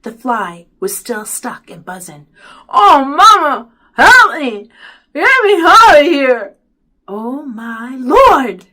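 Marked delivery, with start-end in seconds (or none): 2.7-6.6 loud, high garbled plea; muffled wailing. A narrator's reading. Byron begs mother to free him, garbled wailing. 7.1-8.7 slow, loud gasp of horror; mother's scream. A narrator's reading. Mother sees son frozen to mirror, slow horrified cry. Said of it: "Mama screamed".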